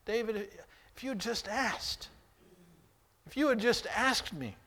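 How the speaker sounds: pleading God. Acted.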